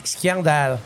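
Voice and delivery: "scandale" in Grenoble accent, said "skian-dal". Shown as a demonstration. Local showing the radio host how Grenoble says it, Grenoble accent. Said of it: "il y a de l'accent".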